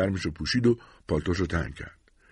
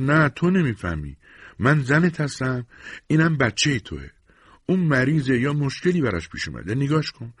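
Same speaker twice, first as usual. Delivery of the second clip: upset pleading; 7 semitones higher, 3 dB louder than usual. Acted. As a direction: press each word home, upset about the baby. Wife confronts husband over sick baby.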